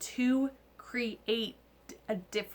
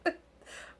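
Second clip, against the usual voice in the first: laughing groan; 4 semitones higher, 11 dB louder than usual. Retold or imitated. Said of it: "I'm like".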